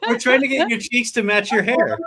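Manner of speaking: high, giggly teasing through laughter; laughing. Real, not acted. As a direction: laugh while teasing her about blushing. Teasing guest about blushing.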